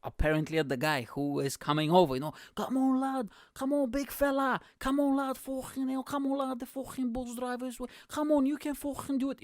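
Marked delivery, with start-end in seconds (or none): 2.5-9.4 very high mock Scouse yell; hammed-up Scouse cheering-on, pitched way up; screaming Scouse lad. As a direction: urge a straggling mate on in exaggerated Scouse. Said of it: "and he starts screaming".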